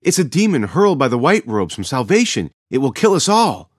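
loud, high, alarmed proclamation; a self-important villager shouting a prophecy of doom; said very loudly. A narrator's reading. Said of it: "said very loudly to anyone who would listen".

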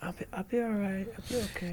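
real strained, very soft and high squeak; voice giving out. Real, not acted. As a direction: reassure them with almost no voice left. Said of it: "I can't talk".

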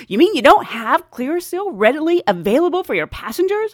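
loud, high-pitched incredulity; exaggerated scandalised question; mock indignant passenger. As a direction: replay the affronted customer, hammed up. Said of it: "replaying what she just did".

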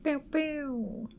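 squeaky laser pew pew; high "pew pew" sound effect. Pretend. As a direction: toss in a playful high laser noise. Playful cheer after patron name.

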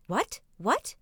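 questioning word in a hint of Trevis dialect. A narrator's reading. Puzzled man across the river, Trevis dialect. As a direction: ask lightly in Trevis speech. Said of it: "in the way people speak in the neighborhood of Trevis".

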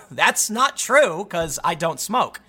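slow, drawn-out mock denial; hammed-up, slowly spelled-out protest; exaggerated denial. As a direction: stretch each word out for comic effect. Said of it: "I didn't say it like that".

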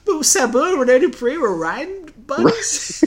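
high, laughing disbelief; can't believe it, half laughing; incredulous. Real, not acted.

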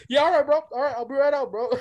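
flustered call. Retold or imitated. Boy answers kid knocking on the door, flustered. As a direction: call back hastily through a door.

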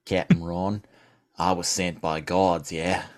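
put-on low, slow Australian accent; Australian polar bear. Polar bear telling the captain who sent him, low Australian. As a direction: drop low and soft, drawl in Australian. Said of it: "leaning into my Australian polar bear".

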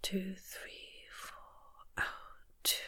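whispered counting on quick breaths; breathing from the chest. Shown as a demonstration. Coach demonstrates chest breathing, whispered count. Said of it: "I'm breathing too fast".

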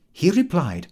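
usual speaking voice

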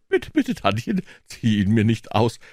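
put-on very high, lively boy's begging; suddenly wide-awake, high protest; hurried pleading. A narrator's reading. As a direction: beg quickly to be spared. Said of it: "Eiligst rief Tom".

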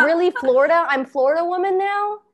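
playful indignation at being called Florida woman; mock indignant. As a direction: scoff at the idea. Retelling her indignant reaction.

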